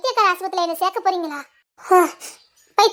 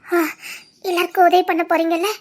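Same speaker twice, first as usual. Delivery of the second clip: ordinary talk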